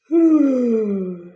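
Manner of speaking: high, loud, drawn-out sigh; big exaggerated sigh; long sigh. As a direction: let out a long, heavy exhale. Reacting to the retold scolding.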